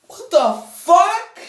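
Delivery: high, loud, slow squeal of disbelief; squealed outrage. Real, not acted. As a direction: squeal it like the game betrayed you.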